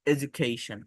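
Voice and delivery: careful model word; formal pronunciation. Shown as a demonstration. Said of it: "formal pronunciation".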